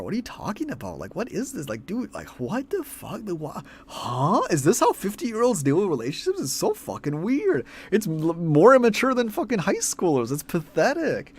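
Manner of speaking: high falsetto disbelief; incredulous mock whine. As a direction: rant in a squeaky, exasperated falsetto. Mocking incredulous reaction to the quote.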